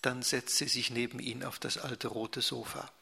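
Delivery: plain reading voice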